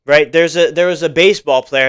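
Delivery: usual speaking voice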